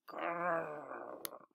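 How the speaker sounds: low, soft little growl; puppy growl. A narrator's reading. Puppy chewing the pizza box, growling.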